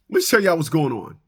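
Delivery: ordinary delivery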